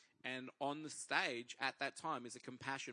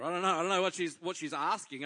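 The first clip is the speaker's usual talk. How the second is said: loud, raised-pitch mock confusion; loud bafflement; 4 semitones higher, 10 dB louder than usual. Storyteller baffled by a stranger's question, loud mock confusion. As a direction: blurt it out, acting baffled.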